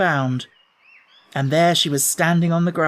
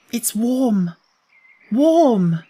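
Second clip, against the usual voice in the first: higher, a louder second warm, wondering; delighted; 7 semitones higher, 4 dB louder, much slower than usual. Acted. Mary at the window delights in the warm sun, higher and louder. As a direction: feel the sun on your hand and marvel.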